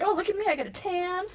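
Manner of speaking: high-pitched sassy showing off; mock-vain, sing-out high quote. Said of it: "I felt really sassy".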